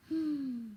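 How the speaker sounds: high, audible gasp of alarm; frightened gasp. A narrator's reading. Percy catches sight of the wolf, startled gasp. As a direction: draw in a startled breath.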